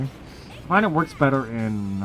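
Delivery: ordinary talk, nothing marked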